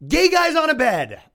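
high, loud comic exclamation; hammed-up incredulous holler; mock outraged shout. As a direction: yell it in comic disbelief. Exclaims again in mock disbelief.

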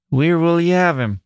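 a pit manager asking where to lay the dead man; old pit manager. Acted. Old manager's dialect question.

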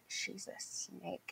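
a quiet, slow "she's a snake" aside; hushed warning. Retold or imitated. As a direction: lean in and warn low under your breath. Mover warns quietly about landlady.

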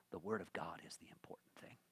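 intense whisper, quick. Acted. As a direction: whisper it like a secret. Key line whispered to congregation.

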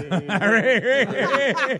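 loud burst of laughter; big laugh. Real, not acted. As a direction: let the laugh explode. Laughing fit at hat.

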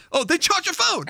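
high, sing-song mock of the slogan; mock ad viewer. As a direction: viewer mocking the ad line on CNN, high and teasing. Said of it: "That should be their slogan".

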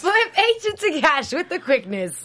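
hammy commercial announcer; infomercial. Pretend. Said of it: "sounds like an infomercial".

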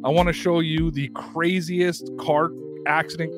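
ordinary delivery

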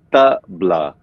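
tabla split into TUH, then BLAH; syllable-by-syllable pronunciation. Shown as a demonstration. Musician teaching the host, tabla as TUH... BLAH. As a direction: break tabla into two separate syllables. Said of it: "almost like how you would say the".

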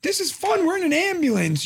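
put-on high, delighted kid voice; little son. Son held in the ambulance, high delighted kid voice. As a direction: imitate a small boy on an adventure. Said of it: "and he's like".

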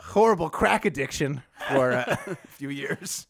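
slow words broken by laughter; laughing. Real, not acted. Storyteller jokes about a crack habit, laughing through it. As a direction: crack up while stretching out the joke.

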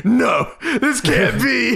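high, slow, laughing disbelief; drawn out in shocked denial; stunned disbelief. Retold or imitated. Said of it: "I was like".

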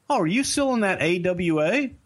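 high-pitched, innocently curious cop; clueless cop. Retold or imitated. Cop poking his head in the window, clueless cop. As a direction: ask with naive fan interest. Retelling clueless cop's question to Dusty.

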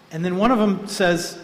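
ordinary talk

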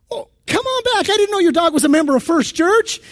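a pastor hearing about five hundred dollars, fawning; greedy pastor. Retold or imitated. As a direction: flip to a fawning, eager pastor voice. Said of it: "He says".

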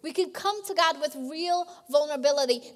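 normal talking voice

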